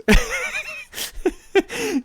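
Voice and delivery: long, high-pitched giggling fit; laughing. Real, not acted. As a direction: break up laughing at your own tangent. Laughing at own tangent.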